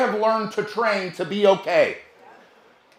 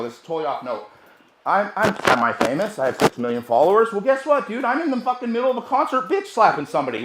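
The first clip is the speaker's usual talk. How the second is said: ordinary talk, nothing marked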